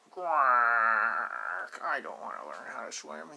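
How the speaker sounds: loud quack, then a stubborn duckling refusal; loud quack into a pouty no. Acted. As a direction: quack hard, then sulk. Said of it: "he says".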